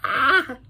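sharp high scared yelp. Retold or imitated. Kid acting out Jared's fright, high yelp. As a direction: yelp like you just got spooked. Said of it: "He was like".

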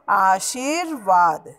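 आशीर्वाद slowly, r clearly after "shee"; careful model pronunciation. Shown as a demonstration. Teacher models the r's place, slow आशीर्वाद. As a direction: stretch aa-sheer, then vaad in आशीर्वाद. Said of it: "श के बाद र".

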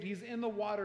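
ordinary talk

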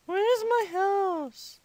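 slow, stretched "Where's my house?"; drawn-out question. Pretend. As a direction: kid wondering aloud, dragging out each word. Lost, searching for house in game.

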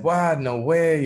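astonished, voice jumping up; retold disbelief. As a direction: imitate a friend caught by surprise. Friend reacts to coming-out in retold dialogue.

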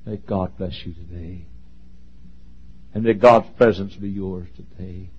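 a soft, heartfelt benediction; sincere blessing. Shown as a demonstration. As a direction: bless them gently, meaning it. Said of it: "look at 'em and say to them".

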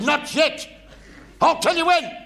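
high, loud drill sergeant bark; barking chief petty officer. Retold or imitated. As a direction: bellow it like a feared naval chief. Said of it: "he said".

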